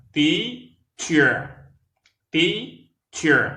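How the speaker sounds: Teacher said high and loud, TEA stressed, clear ch; two syllables TEA-cher; model pronunciation. Shown as a demonstration. Teacher modelling Teacher for learners. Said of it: "En mettant la langue entre les dents".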